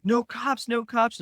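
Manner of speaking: high-pitched retold panic; quick re-enactment of high, anxious protest; panicky plea. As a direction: imitate yourself pleading in a panic. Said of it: "I'm freaking out".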